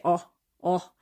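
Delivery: Scandinavian "oh, oh", short and pure with no glide; pure monophthong "oh" in a Scandinavian accent. Shown as a demonstration. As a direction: clip "oh" short the way a Swede or Finn would. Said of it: "if you're from, uh, Finland or Sweden, you'd say".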